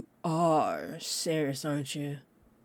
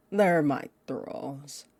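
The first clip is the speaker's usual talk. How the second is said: normal talking voice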